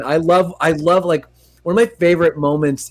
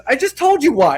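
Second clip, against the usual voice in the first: much higher, a touch exasperated; quoting Vinny; 9 semitones higher than usual. Retold or imitated. Vinny arguing with Mona Lisa, mild exasperation. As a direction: snap back in a lightly voiced Vinny. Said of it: "And he goes".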